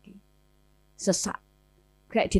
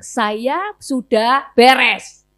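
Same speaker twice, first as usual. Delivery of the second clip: drawn-out, louder insistence that it's settled; emphatic denial; 5 dB louder, much slower than usual. Retold or imitated. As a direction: insist firmly, stretching the words out. Retold denial that all is settled. (bantahan tegas, diulur)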